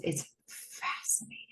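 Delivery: breathless awed whisper; whispered awe. Retold or imitated. Jo staring at the live coffee pot feed, awed whisper. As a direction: hush it as if spellbound.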